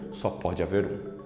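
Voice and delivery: hushed, low film line; solemn movie quote. Acted. Teacher recalling Highlander's rule for students, low and solemn. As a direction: drop low and soft, portentous.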